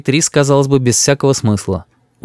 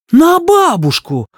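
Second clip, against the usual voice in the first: high, slow gasp of shock; aghast; slower than usual. A narrator's reading. Grandmother called names by grandson, aghast. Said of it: "ахает бабушка".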